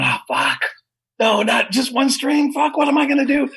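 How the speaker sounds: frustrated panic. Retold or imitated. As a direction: replay the moment of dismay, pitch rising. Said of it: "I was a little frustrated".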